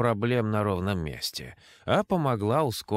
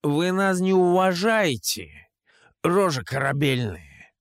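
put-on drunk shouting; 6 semitones higher, 4 dB louder than usual. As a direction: bellow it, drunk and offended. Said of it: "продолжил он заплетающимся языком".

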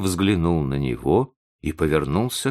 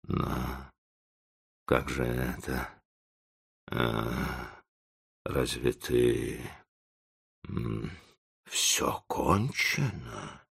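low, very soft, halting murmur; dumbfounded; 6 semitones lower, 8 dB quieter, much slower than usual. A narrator's reading. Old professor takes in the break, dumbfounded and faltering. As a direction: stammer it slowly, fading almost to a whisper. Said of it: "больше и больше изумления".

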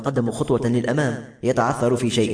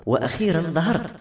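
higher, mildly angry reproach; a touch of anger on a raised pitch; 7 semitones higher than usual. A narrator's reading. Maram, peering from behind Kloda at Anas, angry. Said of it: "وقالت بغضب".

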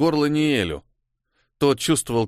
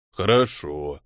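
slow, drawn-out low okay; 8 semitones lower, slower than usual. Acted. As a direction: stretch the word lazily, low. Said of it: "протянул тот". (протяжно, низким голосом)

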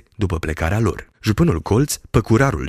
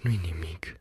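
very soft, weak murmur; barely audible voice; 12 dB quieter than usual. A narrator's reading. Injured man calming his fiancée, barely audible. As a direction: breathe it out, hardly heard. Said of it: "cu un glas într-adevăr de-abia auzit".

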